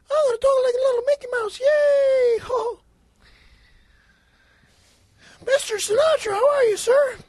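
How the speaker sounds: squeaky cartoon-mouse boy voice; high kiddie voice. Young fan greeting [name], high kiddie voice. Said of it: "in a high voice 'cause you're younger".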